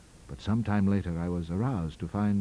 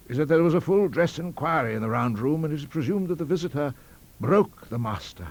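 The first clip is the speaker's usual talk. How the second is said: put-on higher, louder old college don; elderly academic; 8 semitones higher, 4 dB louder than usual. A narrator's reading. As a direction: play the rambling madman telling college gossip.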